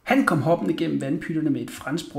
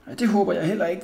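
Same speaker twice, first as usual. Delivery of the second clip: ordinary delivery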